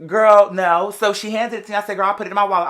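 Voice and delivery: usual speaking voice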